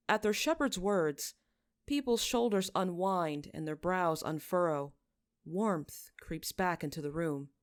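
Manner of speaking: plain reading voice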